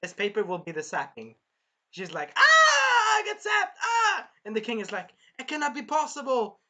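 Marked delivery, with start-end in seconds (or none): 2.4-4.3 very high, loud mock shrieking; hammed-up squealing in pain; zapped screaming. Acts princess being zapped. 5.4-6.6 high-pitched mock disbelief; imitating a king aghast; shocked king.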